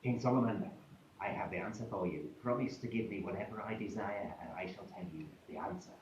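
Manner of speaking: low, fading, creaky hag whisper; ugly old hag. Acted. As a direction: croak it low like a one-toothed crone. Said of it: "the ugly old hag".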